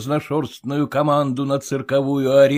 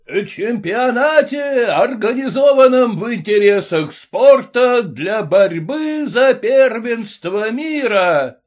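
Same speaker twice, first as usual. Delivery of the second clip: ringmaster announcement; 10 semitones higher, 4 dB louder than usual. A narrator's reading.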